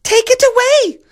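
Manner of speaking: extremely high, loud echoed order; echoing ladies-in-waiting. A narrator's reading. Ladies-in-waiting to the messenger, high loud echo. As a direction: parrot the order in a piercing squeak. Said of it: "said the ladies-in-waiting to the messenger".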